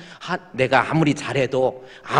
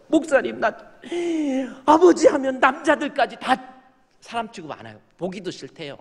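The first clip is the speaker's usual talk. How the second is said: tearful daughter; 10 semitones higher than usual. Retold or imitated. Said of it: "울면서".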